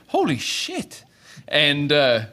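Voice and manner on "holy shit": much higher, breathy amazement; amazed recruits. Acted. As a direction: gasp it out in awe. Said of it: "they go like".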